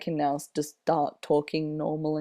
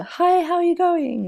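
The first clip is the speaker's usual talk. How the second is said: very high, louder put-on friendly voice; high friendly greeting; 13 semitones higher, 5 dB louder than usual. Mimicking the nice friendly person greeting people, high-pitched. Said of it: "Nice friendly person".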